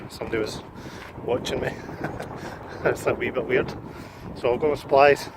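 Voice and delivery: ordinary talk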